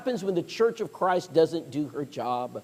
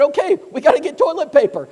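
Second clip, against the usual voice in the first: much higher, louder, alarmed; sudden panic; 7 semitones higher, 7 dB louder than usual. Retold or imitated. Preacher deciding to grab toilet paper, alarmed blurt.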